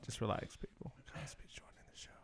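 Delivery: breathy whispered announcement, hushed. Pretend. A host whispering to listeners before Bill Cosby's entrance, breathy hush. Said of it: "Shh, quiet".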